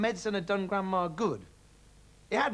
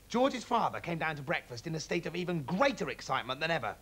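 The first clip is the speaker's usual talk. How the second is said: ordinary delivery, nothing marked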